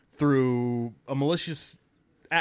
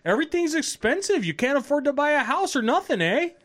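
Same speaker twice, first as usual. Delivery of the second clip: high-pitched comic complaint; mock griping in a high voice; imitated Canadian heckler; 10 semitones higher than usual. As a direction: play an aggrieved Canadian local, pitched way up. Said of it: "He's like".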